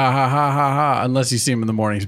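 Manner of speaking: usual speaking voice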